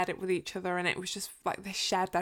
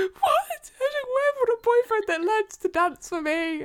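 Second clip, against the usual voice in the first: high, loud swooning voice; swooning squeal; 5 dB louder, slower than usual. Retold or imitated. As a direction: squeal it like a fangirl. Acted swooning quote.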